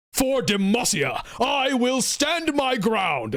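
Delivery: slow, high, booming noble knight battle cry; Garen. Acted. Streamer badly imitating a noble knight for chat, heroic impression. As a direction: draw out a hammed-up heroic knight's proclamation. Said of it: "this is a horrible impression, but I'm gonna try and do it".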